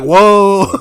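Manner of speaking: hammed-up startle; crowd's alarmed reaction. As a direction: imitate a crowd backing off. Imitating crowd shocked by threat.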